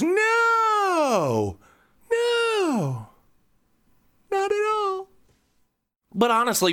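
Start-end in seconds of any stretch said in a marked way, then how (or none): 0.0-5.1 squeaky high hammed-up "No, not at all"; mock eager denial. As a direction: reassure her in a much higher, overeager voice. Mock eager reassurance to wife.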